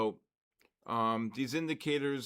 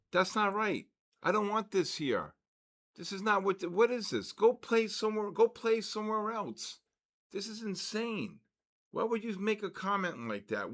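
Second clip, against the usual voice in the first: higher, exasperated scolding; upset and indignant; 8 semitones higher than usual. Real, not acted. Streamer scolds a chat troll, indignant and high.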